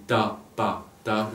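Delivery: "Tapata" slowly, as three separate syllables ta, pa, ta; syllables separated. Shown as a demonstration. Teacher showing the three syllables of an invented word, syllables separated. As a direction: break "Tapata" into ta... pa... ta, evenly spaced. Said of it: "je les sépare bien toutes les trois".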